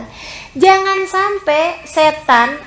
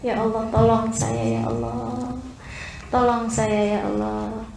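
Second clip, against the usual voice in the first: hushed, low, intimate plea to God; pleading prayer; 5 semitones lower, 10 dB quieter than usual. Acted. Lost servant begging God to show the way, hushed pleading prayer. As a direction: plead very softly, as if alone with God. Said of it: "Kamu berdoa manggil nama Allah".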